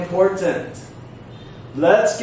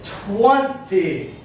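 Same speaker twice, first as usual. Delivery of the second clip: first syllable of Twenty raised, second falling; stress on TWENty. Shown as a demonstration. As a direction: hit TWEN, then let ty drop. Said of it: "It goes up".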